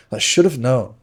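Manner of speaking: sudden furious outburst; erupting in anger. A narrator's reading. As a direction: explode at your friend. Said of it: "And I erupt".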